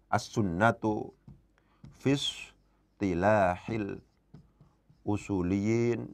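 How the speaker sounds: each Arabic word drawn out slowly for students to write; slow dictation. Shown as a demonstration. Teacher dictating an Arabic phrase to the class, slow and deliberate.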